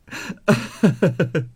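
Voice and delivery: stuttering high-pitched wail; high sobbing. Acted. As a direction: sob wordlessly in a string of short cries. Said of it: "凤姐儿哭道". (连声抽泣哭号)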